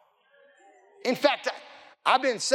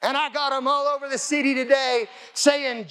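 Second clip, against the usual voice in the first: normal talking voice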